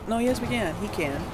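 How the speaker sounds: ordinary talk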